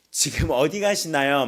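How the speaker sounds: loud, slow, stilted line reading; hammed-up amateur acting; deliberately bad acting. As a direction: overact it like a hopeless drama student. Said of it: "이렇게 발연기를".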